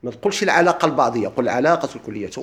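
ordinary delivery

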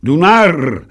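high, loud roar. Acted. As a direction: roar the insult. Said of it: "rührte der Kapitän". (lautes Brüllen)

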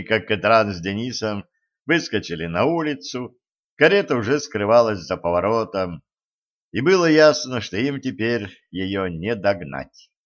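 usual speaking voice

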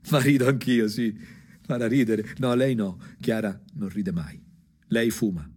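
ordinary talk

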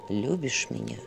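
soft, low shadowy voice; quiet voice. A narrator's reading. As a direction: say it softly from the dark. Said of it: "послышался тихий голос".

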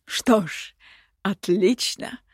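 lightly cheered approval; a ruler suddenly entertained; mildly gleeful acceptance; amused. A narrator's reading. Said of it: "развеселился повелитель".

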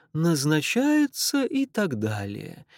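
slow, ceremonial Irish voice; Irish accent. Acted. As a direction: knight him slowly, Irish lilt. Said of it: "сильный ирландский акцент".

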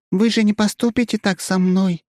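high, pleading, meek bleat; pitiful bleat. A narrator's reading. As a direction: beg with fluttering lashes. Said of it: "жалостно ... блеет псевдосоколова".

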